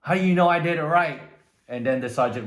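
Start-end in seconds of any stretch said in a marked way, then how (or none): 0.0-1.2 higher, much louder shouted reply; recruit screaming back. Retold or imitated. Young recruit yells back at the sergeant, loud and high. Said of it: "the young recruit screamed back".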